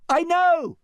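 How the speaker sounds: high, loud call; crying out. A narrator's reading. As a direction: shout back happily. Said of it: "cried Fiona".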